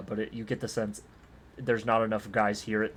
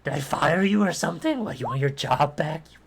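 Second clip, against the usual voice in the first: higher, louder panicky bluster; blustering halfling; 6 semitones higher, 5 dB louder than usual. Acted. Halfling boss scared and defensive toward Jack, high panicky bluster. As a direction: babble scared demands at the intruder.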